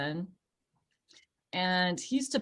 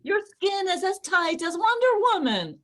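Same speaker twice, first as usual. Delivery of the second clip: high, loud, certain affirmation; definite tone; 12 semitones higher, 5 dB louder than usual. Pretend. [name] giving herself mirror affirmations in a chef's sure tone. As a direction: declare it like an Italian chef would. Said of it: "that same definite tone".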